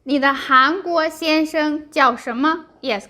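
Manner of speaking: normal talking voice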